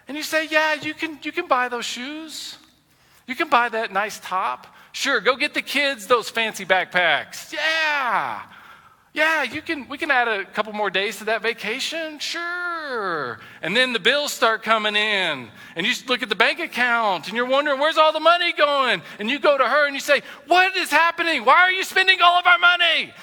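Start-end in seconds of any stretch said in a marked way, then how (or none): 0.5-13.4 high, drawn-out, breezy yeses; hammed-up generous husband, stretching the words; over-indulgent agreeing. Husband okays wife's shopping, exaggeratedly agreeable. 17.8-19.0 high, loud, indignant question; acted-out panic rising toward a yell; alarmed exasperation. Husband seeing the bank account, alarmed and loud. 20.5-23.1 high, loud, incredulous shout; hammed-up blow-up, stretching the last words; outraged yelling. Husband confronts wife over spending, outraged yell.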